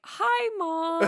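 high, drawn-out awkward hello; mock awkward greeting. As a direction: imitate being caught out by mom. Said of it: "It's a great awkward line".

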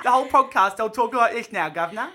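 loud, hammed-up British "governor" voice; British accent. Shown as a demonstration. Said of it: "speak in a British accent".

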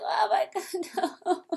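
high, slow laughing oh my God; laughing mock dismay. Friend retelling the movie, high laughing dismay. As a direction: giggle through a mock groan. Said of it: "he's like".

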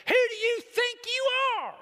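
very high, loud acted shout; furious yell at full pitch; roaring outrage. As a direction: roar it at God. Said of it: "roars up inside her".

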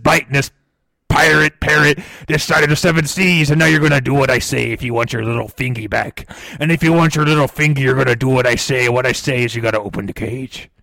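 usual speaking voice

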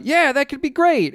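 high, eager goofball voice; eager friend voice. Retold or imitated. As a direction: chirp it like a dopey buddy. Friend voiced agreeing to plan.

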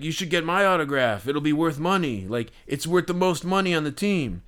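a hint of a pushy braggart; mock boastful stranger. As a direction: imitate a guy bragging about his autograph. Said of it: "this guy, like, leans over to me".

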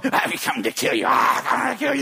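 growing threat from an imitated demon; possessed voice. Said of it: "a voice is going".

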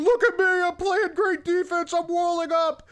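loud, high boastful crowing; mock boasting. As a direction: crow about your own defense, high and loud. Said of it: "I would have expected something about walling up, going".

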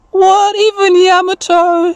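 put-on high, drawn-out surprised kid character; high, incredulous little voice; high-voiced T K. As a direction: squeak it out in slow disbelief. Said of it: "says T K".

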